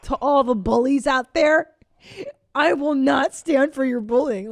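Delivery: hammed-up stand against the bullies; imitated defiant speech. Mimics videos addressing bullies.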